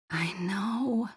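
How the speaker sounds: dazed whisper; soft stunned whisper. A narrator's reading. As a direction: breathe it out, half in a daze. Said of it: "I whispered dazedly".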